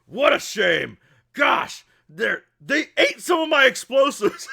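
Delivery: high, loud hammed-up lament; exasperated shout. Grant watching rats attack his workers, exasperated yelling. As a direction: yell it in mock outrage, voice shooting up. Grant yells during rat fight.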